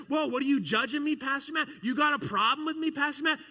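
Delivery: high, loud indignant student voice; mock-offended teen. Students challenging the pastor, loud mock indignation. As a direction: ham up a teen taking offence.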